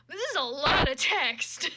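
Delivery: exasperated. Real, not acted. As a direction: groan at the wall of text. Exasperated at long fanfic passage.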